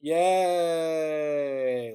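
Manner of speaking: soft, half-hearted crowd yay; a deflated, unenthused cheer; mock limp cheer. Mocking the crowd's weak response, flat soft cheer. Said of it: "the response was".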